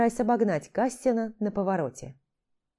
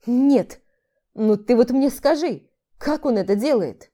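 amazed; 4 semitones higher, 7 dB louder than usual. Acted. Amazed friend asks how.

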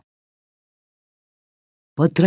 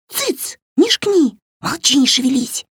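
hushing doll; 10 semitones higher than usual. A narrator's reading. Кукла шикает на Варю.

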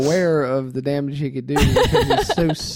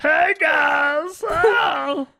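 high shouting goofy dinosaur; 13 semitones higher, slower than usual. Pretend. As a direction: yell like a cheerful dumb dinosaur. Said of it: "dinosaur talking impression".

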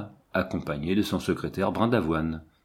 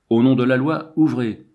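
loud, higher-pitched official command; hard, imperious; 4 semitones higher, 7 dB louder than usual. A narrator's reading. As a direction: call it out forcefully through the door. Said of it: "une voix dure, impérieuse". (sommation forte et impérieuse)

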